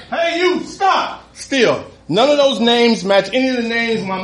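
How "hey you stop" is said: much higher hey you stop, laughing; hammed-up high cop call; mock cop yell. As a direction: play the cop's call getting more insistent. Cop calling out louder, retold.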